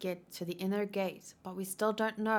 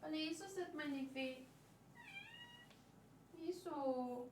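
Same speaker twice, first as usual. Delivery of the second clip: softer, gentle coaxing to a cat; soft pet talk; 4 semitones higher, 12 dB quieter than usual. Real, not acted. Talking softly to her cat.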